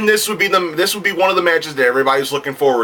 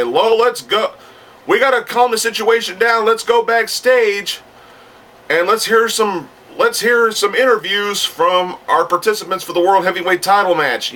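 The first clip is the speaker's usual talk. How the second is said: raised-pitch ring commentator voice; Gorilla Monsoon commentating; 6 semitones higher than usual. Acted. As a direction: step in as the announcer handing off backstage. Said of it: "Gorilla Monsoon, and he would say".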